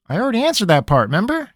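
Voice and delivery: fast, sheepish reminder; abashed grin. Acted. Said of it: "with an abashed grin".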